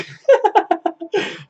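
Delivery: loud high burst of laughter; big laugh. Real, not acted. Streamer laughing at his podcast joke, loud high laugh. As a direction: laugh out hard at your own joke.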